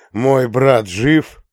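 slow, loud demanding question; menacing demand. Acted. Interrogating a beaten man.

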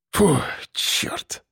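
soft, voiceless puff of relief; exhaled curse. A narrator's reading. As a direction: let the curse out on a breath. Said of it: "выдохнул он". (шёпотом на выдохе)

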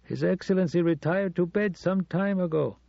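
prim night porter on the line; butler voice. Acted. Butler voice on the phone.

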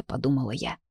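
plain reading voice

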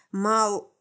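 plain reading voice

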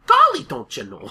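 cartoonish Minnesotan twang; Minnesota accent. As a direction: imitate a Minnesota cow's accent. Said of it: "literally heard one of them go".

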